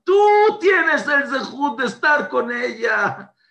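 slow, high, loud cry to heaven; shouting to God. Retold or imitated. Bereaved father shouting his praise to God. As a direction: call out to God with all your strength. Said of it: "Y empezó a gritar".

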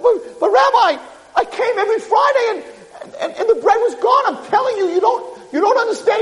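high, stammering protest; desperate pleading. Acted. Peasant insists to angry rabbi the bread vanished, desperate pleading. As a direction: plead your case, tripping over the words.

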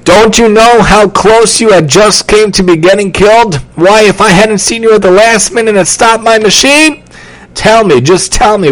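high, urgent telling-off; upset scolding. A narrator's reading. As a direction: demand an explanation from the kid. Worker scolds endangered boy.

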